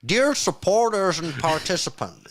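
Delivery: a high, slow, hammed-up Canadian accent; attempted Canadian accent. Reading the letter to the stream in a bad Canadian accent. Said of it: "I don't know what accent that was".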